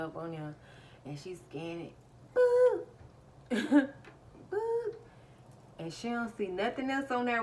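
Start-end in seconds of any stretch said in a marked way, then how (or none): 2.3-2.8 high, loud "boop"; short piercing vocal beep; scanner beep imitation. 4.5-4.9 high, loud "boop"; short piercing vocal beep; scanner beep imitation.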